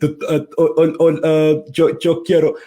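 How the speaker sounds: stammering beginner fumbling for Spanish; mock tongue-tied hesitation; hammed-up fumbling for words; tongue-tied learner. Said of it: "I was getting tongue-tied and be like".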